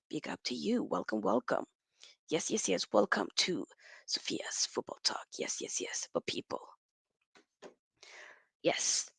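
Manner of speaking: soft, low whisper; whispered welcome. Real, not acted. Whispered greeting to viewers.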